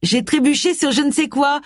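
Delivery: réponse enjouée, voix haute; réponds gaiement, dans l'aigu. A narrator's reading. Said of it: "répondit Jake gaiement". (ton gai et léger)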